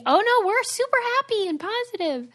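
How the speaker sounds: hammed-up super positive; mock cheery. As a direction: sing it out falsely upbeat. Mocking cheery email tone.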